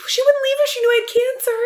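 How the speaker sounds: high squeaky mocking voice of the husband; mock whiny husband. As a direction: parody his pathetic plea in a squeal. Repeating the line in mock voice.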